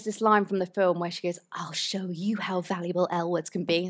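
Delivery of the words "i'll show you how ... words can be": badly faked American accent, softer delivery; terrible American accent. Quoting a line from the film, bad American accent. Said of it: "That was my terrible American accent".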